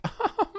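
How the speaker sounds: a high fake girlish giggle; high girly giggle. Mimicking the girl laughing at Rubio's jokes, high girly giggle.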